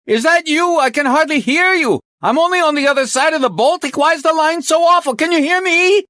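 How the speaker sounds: very high, loud strained shout. Acted. Chief shouting across the Baltic on an awful line, shouting. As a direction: shout to be heard down a bad line. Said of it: "Bjork shouted".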